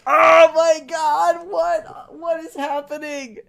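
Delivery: very loud, high, drawn-out freak-out; shocked outcry. Retold or imitated. Fan reliving Discord's arrival, shouting in shock. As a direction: shout it in disbelief, stretching the opening.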